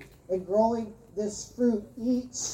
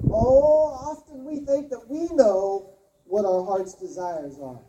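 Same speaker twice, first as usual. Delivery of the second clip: ordinary talk, nothing marked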